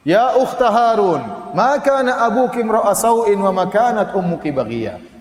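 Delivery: slow chanted Arabic recitation; slow Quranic chant. Acted. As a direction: recite the verse slowly, melodic.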